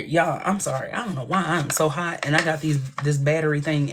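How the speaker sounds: usual speaking voice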